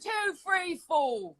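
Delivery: counting in a hammed-up Manchester accent, pitched high; counting in mock Manchester. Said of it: "Let's hear it".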